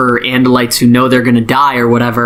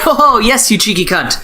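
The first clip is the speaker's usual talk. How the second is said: gleeful mock agreement; 7 semitones higher than usual. Axe agrees, voiced in retelling.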